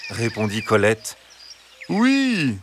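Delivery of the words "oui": high, slow, sweet fox voice; soft reassuring fox. Acted. Fox at the henhouse gate, soft reassuring high voice. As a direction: coax them gently, far too sweetly. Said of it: "répondit-il".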